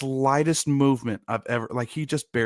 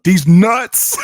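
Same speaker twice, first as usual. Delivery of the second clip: loud, high punchline; punchline prank; 6 semitones higher, 12 dB louder than usual. Retold or imitated. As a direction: blurt the gag out loud. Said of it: "He was like".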